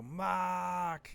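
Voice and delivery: high, drawn-out Boston "Mark"; light Boston impression of a buddy's name; mock Boston accent. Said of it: "'cause he had this wicked Boston accent".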